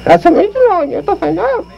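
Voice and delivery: very high squeaky dummy voice; high puppet voice; Pee Wee dummy voice. Acted. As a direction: pitch way up for the puppet. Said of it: "here's my ventriloquist act".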